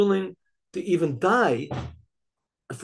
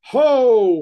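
long, loud, high battle yell; executioner's yell; 6 semitones higher, 11 dB louder than usual. Acted. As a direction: let out one drawn-out shout as the sword falls. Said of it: "a yell".

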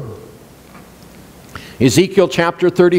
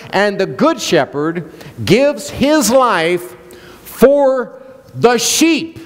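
slow reading; 3 semitones higher, slower than usual. Shown as a demonstration. Slow preaching quote.

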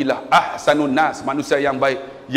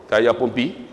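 normal talking voice

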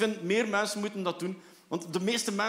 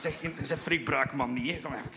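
ordinary talk, nothing marked

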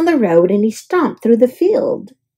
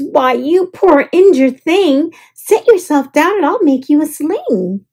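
a high, eager fuss over a hurt creature; eager concern; 6 semitones higher than usual. A narrator's reading. James fusses over the hurt duck, high and eager. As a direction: coo over the poor thing.

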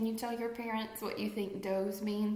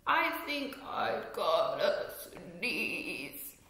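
pig character voice; 3 semitones lower, much slower than usual. Acted. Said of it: "So now the pig has to sneeze".

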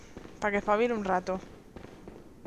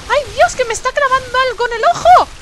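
high, loud alarmed cry; frantic loud cry; panicked shouting; 13 semitones higher, 13 dB louder than usual. Real, not acted. Attacked, yelling in panic.

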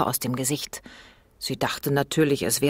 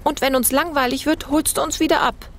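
lightly voiced little girl, higher; young girl; 12 semitones higher, 3 dB louder than usual. A narrator's reading. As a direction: ask it like a cheeky seven-year-old. Anne spricht zur Mutter. (leicht gespieltes Mädchen)

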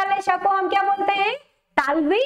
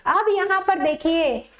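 normal talking voice

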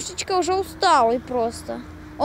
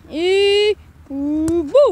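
ordinary talk, nothing marked

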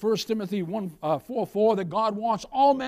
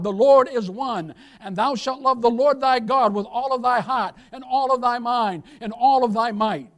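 proclaimed scripture; 4 semitones higher, 5 dB louder than usual. Acted. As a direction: call it out like a solemn declaration. Preacher proclaims the Shema aloud.

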